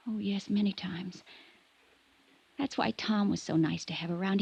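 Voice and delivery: ordinary talk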